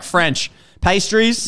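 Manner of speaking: slow listing. Retold or imitated. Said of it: "I was like".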